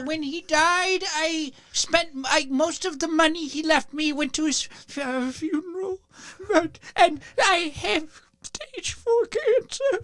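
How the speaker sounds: put-on high, very slow quavery old lady; very old woman. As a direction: plead like a frail widow. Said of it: "You go".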